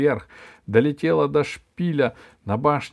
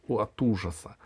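ordinary delivery

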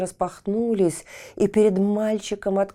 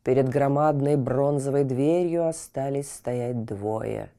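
plain reading voice

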